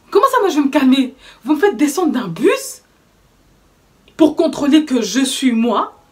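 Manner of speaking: mock fury at being stopped; retold outburst. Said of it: "péter un plomb".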